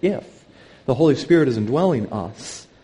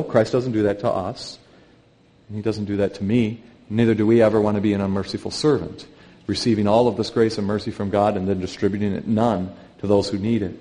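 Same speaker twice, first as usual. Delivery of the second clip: ordinary delivery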